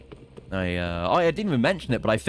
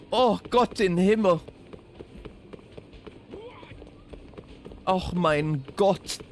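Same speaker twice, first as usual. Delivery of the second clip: higher, dismayed German exclamations, the last one drawn out slowly; Caleb exclaiming; 9 semitones higher, slower than usual. Retold or imitated. Streamer's second Caleb impression, mock German despair. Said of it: "It's either that or".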